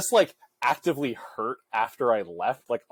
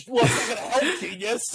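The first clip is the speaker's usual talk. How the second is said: laughing; 10 semitones higher than usual. Real, not acted. Laughing teasing retort.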